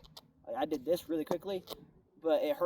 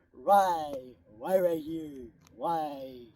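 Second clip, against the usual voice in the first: mock lament; 4 dB louder, much slower than usual. Mock lament over injury spot.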